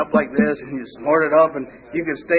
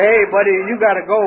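raised voice telling him to leave; firm, loud get-out; loud order; 4 semitones higher, 6 dB louder than usual. Retold or imitated. Telling his buddy to go, loud and firm.

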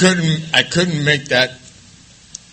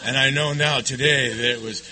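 ordinary talk, nothing marked

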